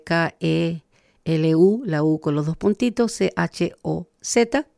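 letters K E L U C H O Z spelled slowly; slow deliberate spelling for learners. Shown as a demonstration. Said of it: "la pronunciación de la primera parte".

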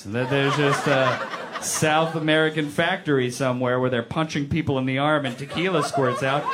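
ordinary talk, nothing marked

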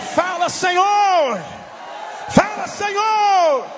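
high, loud, drawn-out congregational call; real preacher's cry, pitched high and stretched out; rallying shout. Real, not acted. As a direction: shout it slowly up to heaven. Leading the congregation in responsive shouts.

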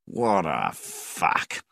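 long drawn-out, contained outburst of anger; mild yell. A narrator's reading. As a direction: stretch each word very slowly in exasperation. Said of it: "he yelled aloud".

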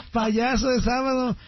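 wife calling him a clown; teasing wife. Retold or imitated. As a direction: tease him like his wife.

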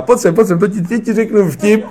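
high, loud, excited beckoning; imitating an overexcited colleague, voice pitched right up; eager joke-teller. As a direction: call her over, bursting to tell a joke. Eager colleague calls woman over.

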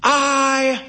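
high, very slow, stretched-out shout; yelled back. A narrator's reading. Woman on a clothesline answering the doorman below, drawn-out yell. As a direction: call back with every word held long. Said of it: "she yelled back".

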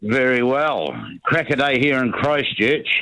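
usual speaking voice

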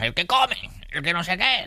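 high-pitched mock fan butting in; pushy fan. Comedian mimicking fans who approach him while eating, high mock voice. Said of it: "entra en plan".

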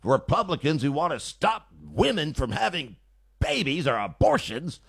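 mock overwrought pundit, pitch swooping; hammed-up emotional outburst; Mika's raw emotion. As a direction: imitate someone near a nervous breakdown on air. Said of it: "that's how she talks".

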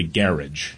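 "garage" stressed on the first syllable; "garage" as GARR-idge; British pronunciation. Retold or imitated. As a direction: say "garage" the British way, rhyming with carriage. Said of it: "they'd pronounce it that way".

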